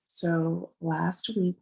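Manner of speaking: ordinary delivery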